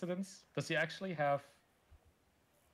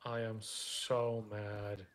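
slow drawn-out complaint; 5 semitones lower, much slower than usual. Pretend. Repeated slower, stretched.

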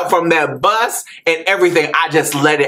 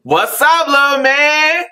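friendly greeting; 11 semitones higher than usual. Retold or imitated. Neighborhood tough greeting the boy on his porch, hearty higher hail. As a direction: call out like the boy's new big fan. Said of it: "the main little thuggy dude was like".

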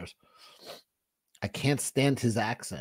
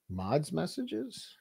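drawn-out, higher and much softer questioning repeat; mock slow echo; 5 semitones higher, 11 dB quieter, much slower than usual. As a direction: stretch it out as a teasing echo. Echoing the request mockingly.

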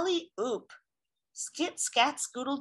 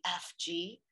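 ordinary delivery, nothing marked

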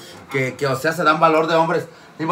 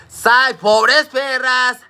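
very slow, higher, louder holler; drawn-out teasing shout; shouted greeting; 9 semitones higher, 7 dB louder, much slower than usual. Real, not acted. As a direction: stretch the call out to the crowd. Shouting greeting to her followers.

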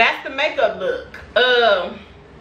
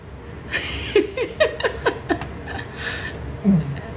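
wheezing laugh; 7 semitones higher, 5 dB quieter than usual. Real, not acted. Laughing at the result.

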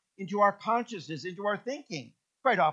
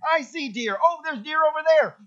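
higher and louder, eager pointing out; excited spotting; 10 semitones higher, 7 dB louder than usual. Retold or imitated. Anne in the car spotting deer, excited and louder. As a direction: call out the deer you just saw.

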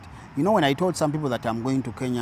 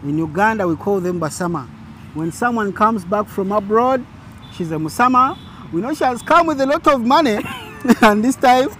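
ordinary talk, nothing marked

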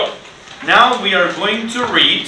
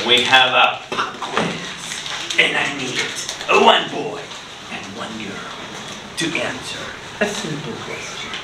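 suspenseful hush; 3 semitones lower, 5 dB quieter than usual. Acted. Teacher teases a pop quiz to the class in a hush. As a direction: draw them in with a secret hush.

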